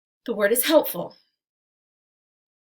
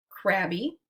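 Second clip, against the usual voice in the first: ordinary talk, nothing marked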